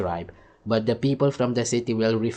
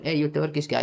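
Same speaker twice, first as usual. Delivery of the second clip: higher-pitched retold call; retold hail; 5 semitones higher than usual. Speaker to a guy he used to know, retold hail. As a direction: re-enact hailing an acquaintance. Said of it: "one day I told him".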